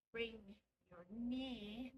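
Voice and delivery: ordinary talk, nothing marked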